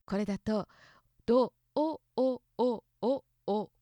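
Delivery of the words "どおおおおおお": どおおおおおお held as a string of o sounds, a bit higher and louder; long o chain. Shown as a demonstration. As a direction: pulse each お in どおおおおおお. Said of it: "九個のおの音が続く".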